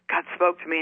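normal talking voice

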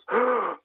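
sharp, high gasp of surprise; a quick, high startled intake of breath; retold gasp. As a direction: gasp as if suddenly amazed. Girl hears God, speaker shocked.

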